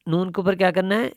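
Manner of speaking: ordinary talk, nothing marked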